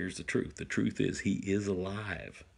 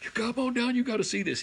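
much higher, eager call up the tree; excited; faster than usual. Retold or imitated. Father calling son down from the tree, excited. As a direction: hurry him down, bursting to show him. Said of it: "I'm just all excited".

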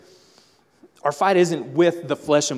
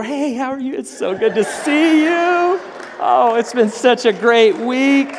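fake cheerful greeting; 9 semitones higher, 6 dB louder than usual. Churchgoer fakes cheer greeting people at the door. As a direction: put on the happy Sunday face.

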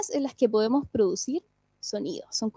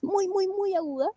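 mock whistle voice; 7 semitones higher, 4 dB quieter than usual. Shown as a demonstration. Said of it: "voz muy, muy aguda que hace [name]".

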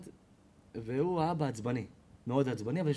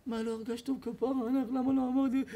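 mock crying; 9 semitones higher than usual. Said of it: "הוא בוכה".

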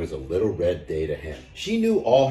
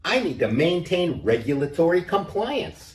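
much higher puppy voice; yappy puppy; 10 semitones higher than usual. A narrator's reading. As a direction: keep the little puppy voice going. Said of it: "barked the finance puppy".

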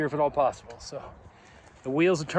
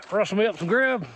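put-on higher, folksy Western accent; put-on cowboy drawl; 7 semitones higher than usual. Hiker joking about dinner in town, put-on cowboy accent. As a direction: play at an old-West cowboy accent. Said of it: "talk with a stupid accent".

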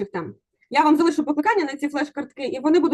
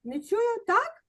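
teasing "I can't hear you"; prodding a shy class to speak up; playful coaxing; 8 semitones higher, 5 dB quieter, much slower than usual. Real, not acted. Teacher coaxes quiet class.

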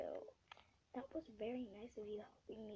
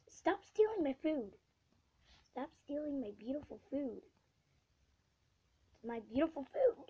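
loud, slightly higher complaint; loud whiny complaint; whiny protest; 4 semitones higher, 7 dB louder than usual. Real, not acted. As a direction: protest at the thief.

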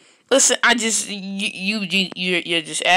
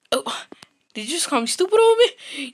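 offended disbelief; 7 semitones higher than usual. Acted. Kid outraged at being called stupid.

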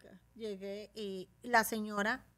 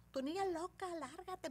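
mocking high, softened scolding; high-pitched imitation of an insulting mother; angry woman; 8 semitones higher, 10 dB quieter than usual. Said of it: "me volvió a decir la señora".

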